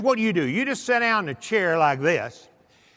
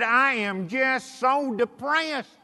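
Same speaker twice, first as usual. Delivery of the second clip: higher, drawn-out mock lament; emphatic complaint; 5 semitones higher, much slower than usual. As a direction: repeat the woe slowly, pitched up to be heard. Repeating her complaint after being questioned.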